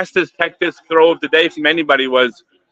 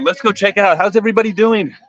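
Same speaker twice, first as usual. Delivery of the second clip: ordinary talk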